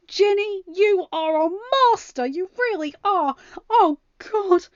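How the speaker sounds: usual speaking voice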